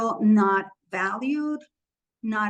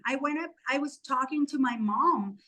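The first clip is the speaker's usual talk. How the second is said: ordinary delivery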